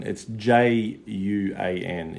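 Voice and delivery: usual speaking voice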